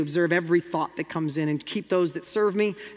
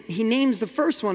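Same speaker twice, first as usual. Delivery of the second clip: ordinary delivery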